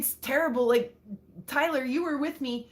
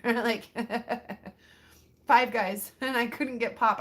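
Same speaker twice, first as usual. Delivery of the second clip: ordinary talk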